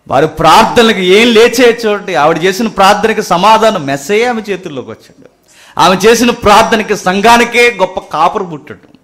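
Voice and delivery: high, loud proclamation; full-force preaching. Acted. Preacher telling the church of her answered prayer, full-force preaching.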